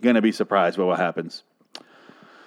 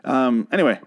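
ordinary talk, nothing marked

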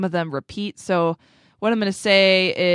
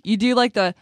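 ordinary talk, nothing marked

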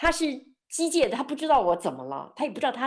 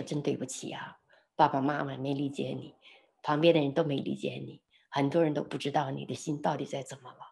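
low, soft comforting tone; retold soothing apology; 6 semitones lower, 6 dB quieter than usual. Speaker apologising to a crying friend for parents and others, soothing. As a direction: soothe someone in tears, voice kept down.

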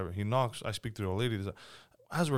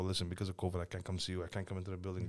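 softer, lower fake covid excuse; mock excuse; 5 semitones lower, 11 dB quieter than usual. Excusing himself to tenants, in a soft, lower mock voice. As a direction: fob off the tenants softly. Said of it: "I go".

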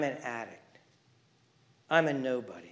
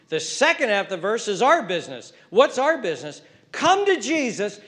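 loud, high sermon cry; full-force preaching; 7 semitones higher, 7 dB louder than usual. Real, not acted. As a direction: preach it to the back row.

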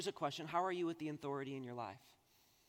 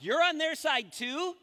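much higher, louder, slow outraged question; betrayed disbelief; 12 semitones higher, 10 dB louder, slower than usual. Retold or imitated. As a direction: cry out feeling betrayed by your dad. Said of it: "I'm like".

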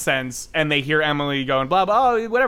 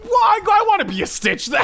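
excited squeal. Real, not acted. Excited reaction to Stitch joke.

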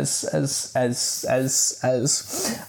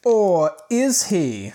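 slow, high dramatic teaser; hammed-up mystery; mock suspense; 5 semitones higher, much slower than usual. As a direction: stretch it out like a cliffhanger. Mock suspense before the twist.

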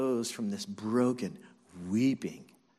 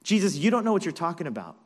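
quick, louder, higher contradiction; brisk emphatic retort; emphatic insistence; 5 semitones higher, 5 dB louder, much faster than usual. Acted. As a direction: insist emphatically, brushing the warning aside. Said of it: "Peter insisted emphatically".

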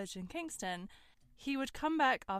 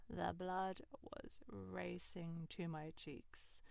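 usual speaking voice

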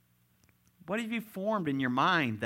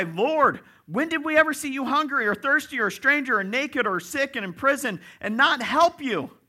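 high, loud indignant plea; loud, high-pitched disbelieving appeal; pleading protest; 12 semitones higher, 9 dB louder than usual. Acted. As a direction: protest it in one rising rush. Acts out the condemned protesting to Lord.

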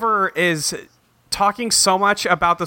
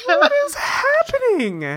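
baffled; slower than usual. Real, not acted. Host baffled by the plot.

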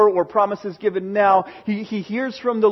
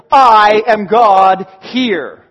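very loud, very slow proclamation; slow, loud, every word weighted; booming God; 9 dB louder, much slower than usual. Acted. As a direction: thunder it out as God. God's climactic claim.

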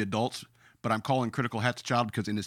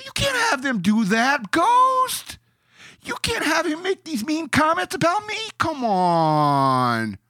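high, loud whining complaint; whiny Critical Hats impression; 7 dB louder, slower than usual. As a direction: whine like a petulant gamer, pitched way up. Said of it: "That's my imitation of Critical Hats bitching".

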